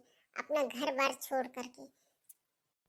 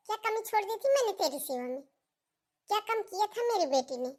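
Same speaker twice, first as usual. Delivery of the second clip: higher, indignant scolding of the bahu; mother-in-law berating her bahu, pitched-up indignation; raised angry accusation; 5 semitones higher than usual. Acted. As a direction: demand an answer, voice pitched up in outrage.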